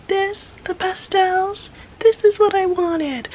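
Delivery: high, slow dreamy TikTok voice; TikTok sound voice. Retold or imitated. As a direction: swoon it like a TikTok audio. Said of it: "the TikTok sound of".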